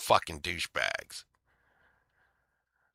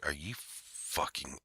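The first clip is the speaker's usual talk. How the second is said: muttered low and much softer, almost to himself; under his breath; 6 semitones lower, 13 dB quieter, slower than usual. Retold or imitated. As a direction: keep the swear inside, barely voiced. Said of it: "I, I didn't say it".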